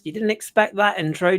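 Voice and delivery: normal talking voice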